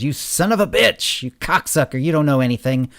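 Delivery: loud, high, furious yell; straining, angry shout. A narrator's reading. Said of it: "Wolf suddenly started yelling".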